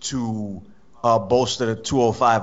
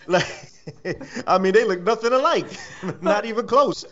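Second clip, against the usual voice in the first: higher, giggling through the words; laughing; 8 semitones higher, slower than usual. Real, not acted. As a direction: laugh while you say it.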